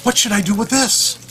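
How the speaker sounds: high, loud mock-mom voice; put-on high-pitched questioning cry as mom; imitated mom.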